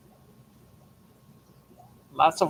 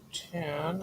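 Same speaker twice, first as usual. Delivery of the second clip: normal talking voice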